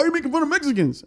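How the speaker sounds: high, fast put-on complaint; high-pitched, rushed accusation; offended heckler. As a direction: imitate an aggrieved audience member. Voicing offended audience member's complaint.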